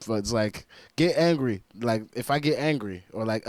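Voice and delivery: usual speaking voice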